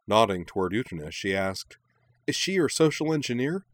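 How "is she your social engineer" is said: high-pitched, excited question; eager excitement. A narrator's reading. Minami nods toward his companion, excited question. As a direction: ask it with giddy curiosity. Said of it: "getting more and more excited".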